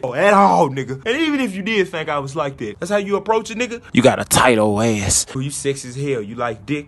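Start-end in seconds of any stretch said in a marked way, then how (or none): 3.9-6.9 lower, slimy imitation of the messager; mock the creepy DM guy; low leering parody voice; mocking creep voice. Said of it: "mocking the DMs in low voice".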